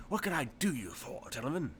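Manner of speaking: hint of over-the-top Cockney salesman; Cockney-tinged tailor. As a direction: greet regulars in a mild Cockney lilt. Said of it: "he's really Cockney instead of".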